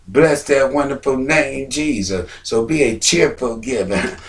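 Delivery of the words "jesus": ordinary talk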